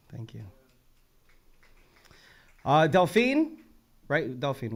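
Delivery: ordinary delivery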